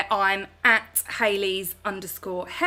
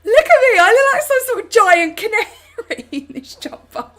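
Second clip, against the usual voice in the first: loud, high-pitched comic wail breaking into laughter; mock wailing; 12 semitones higher, 11 dB louder than usual. Streamer ridiculing own giant canary look, mock wailing.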